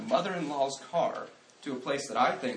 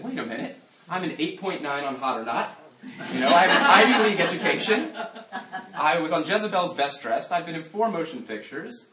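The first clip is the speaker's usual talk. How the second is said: high, loud, laughing show-off voice; lampooning a boastful man who was just turned down; mock bragging suitor; 5 semitones higher, 8 dB louder than usual. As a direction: ham up a smug guy listing his credentials. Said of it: "pulled out a resume of righteousness".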